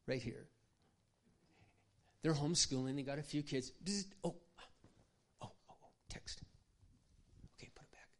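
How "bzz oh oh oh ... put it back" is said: mock startled at a buzzing phone, then hushed; hammed-up distracted young mother; acting out a distracted mom. Shown as a demonstration. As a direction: act out a mom jumping at each text, dropping to a hushed mutter. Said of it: "I'll show you what I see happening".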